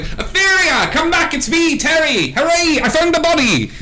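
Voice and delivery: very high, excited distant-hail voice; calling rider. Acted. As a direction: holler joyfully after a departing friend. Terry heard shouting from the hill.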